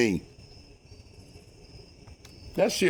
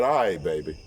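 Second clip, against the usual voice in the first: soothing; 7 semitones lower than usual. Retold or imitated. Accepting proposal in front of people.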